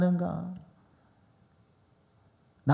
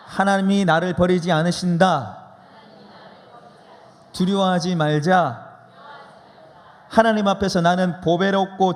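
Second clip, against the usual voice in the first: measured preacher's call, louder than his talk; call and response declaration; 4 dB louder than usual. Shown as a demonstration. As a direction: proclaim each line firmly for them to echo. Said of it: "우리 한번 따라해 보겠습니다".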